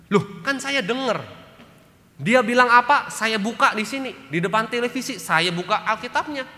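hammed-up defensive reply; smug husband. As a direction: act the husband sure he has the answer. Husband answers wife about Bible.

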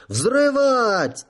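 shrill-pitched command; thin high shout. A narrator's reading. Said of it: "тонким голосом закричал генерал". (тонким голосом)

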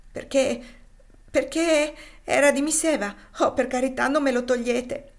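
high, loud, anguished plea; upset child pleading to keep a keepsake; distressed pleading. A narrator's reading.

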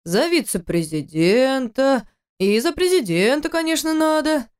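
slow mock-solemn spoon feeding; playful teasing. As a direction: tease her like a child. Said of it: "продолжал развлекаться".

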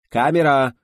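high, loud director's cue; shouted command. A narrator's reading. Director cues the camera operator, shouting. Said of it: "крикнул режиссёр".

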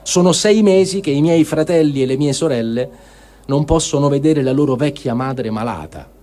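ordinary talk

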